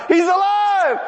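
high, excited preaching shout; triumphant cry; joyful shout. Real, not acted. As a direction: burst out with joy.

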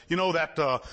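normal talking voice

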